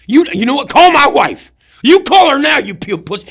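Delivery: high, very loud mock bellow; imitated sergeant's yelling. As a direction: holler at a straggler like a first sergeant. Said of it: "he's yelling so loud".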